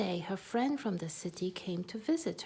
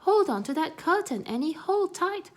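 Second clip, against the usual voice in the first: urgent warning; 8 semitones higher, 5 dB louder than usual. Acted. Warning during the vacuum scare.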